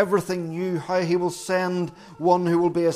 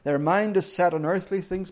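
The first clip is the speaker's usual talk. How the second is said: usual speaking voice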